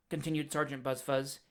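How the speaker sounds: plain reading voice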